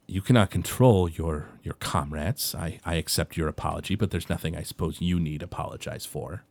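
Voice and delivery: lightly voiced dwarf, low and soft; a hint of a weary, hurt old dwarf; quiet, subdued dwarf speaking from the doorway; Goldbeard.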